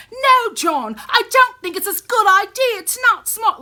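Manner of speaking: whiny, pushy sister shutting him down; mocking Sansa. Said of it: "So here comes Sansa".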